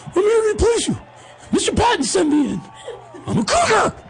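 put-on high falsetto boy reporting for duty; squeaky rookie. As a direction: pipe it up with comic self-importance. Boy self reports to teammate.

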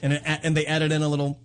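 usual speaking voice

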